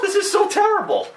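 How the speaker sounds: squeaky high, laughing through the words; high laughing disbelief. Real, not acted. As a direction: giggle it out in disbelief. Laughing at awful game.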